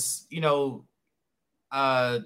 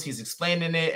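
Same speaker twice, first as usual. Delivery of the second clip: ordinary talk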